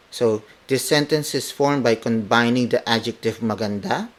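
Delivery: normal talking voice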